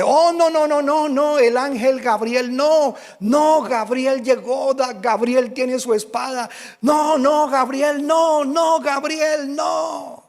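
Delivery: a demon howling in terror at the angel; terrified demon screaming. Retold or imitated. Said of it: "pega un grito este espíritu inmundo".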